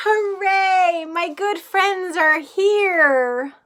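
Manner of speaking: loud, slow, joyful cheer; excited cheer. Acted. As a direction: shout it with delight. Animals arrive, joyful cheer.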